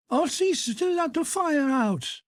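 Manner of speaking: put-on high menacing threat to a child; threatening. Father threatens his son with the walking stick, high menace. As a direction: threaten him as you grab the stick.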